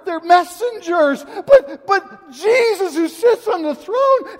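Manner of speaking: loud, high, full-force sermon delivery; impassioned preaching. Real, not acted. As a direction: cry out the sermon with real, swelling emotion.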